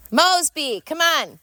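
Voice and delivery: high, bright call to the dog. Real, not acted. Calling to her dog.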